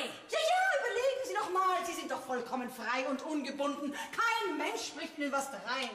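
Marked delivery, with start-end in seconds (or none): 0.0-1.8 eager gush. Acted. Schwärmende Städterin.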